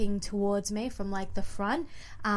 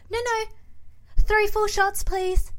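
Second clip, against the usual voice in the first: much higher, a bit louder, pleading; 12 semitones higher, 3 dB louder, slower than usual. Retold or imitated. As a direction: squeak it out politely. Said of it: "I'm like".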